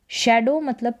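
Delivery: normal talking voice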